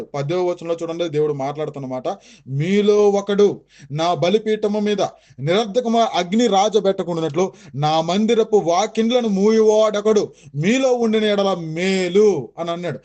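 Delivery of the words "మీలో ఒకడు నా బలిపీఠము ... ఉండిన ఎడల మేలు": very slow, weighty proclamation; scripture reading. Acted. Preacher reading God's words to listeners, very slow and weighty. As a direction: drag out every word like a prophecy. Reads Bible verse aloud.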